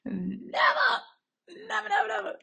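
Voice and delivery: high, loud laughing wail; laughing disbelief. Real, not acted. Vlogger laughing at never watching Bible shows, high wail. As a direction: squeal it through laughter. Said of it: "laughing cry of disbelief".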